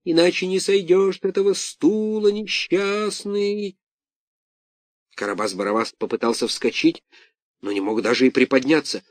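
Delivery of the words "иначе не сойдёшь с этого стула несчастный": high, slow ghostly wail; eldritch horror. A narrator's reading. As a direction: moan it from inside the jug. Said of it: "завыл таинственный голос".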